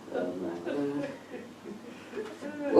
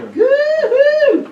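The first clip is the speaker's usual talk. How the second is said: excited whoop; 3 dB louder than usual. Real, not acted. Greeting David in the crowd.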